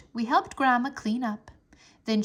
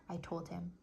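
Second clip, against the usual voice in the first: usual speaking voice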